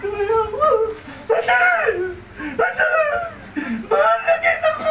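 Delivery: high sobbing cry to the pastor. Retold or imitated. Drunk man crying out to the pastor, high sobbing wail. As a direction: wail it out, breaking into tears. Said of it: "umiyak ako".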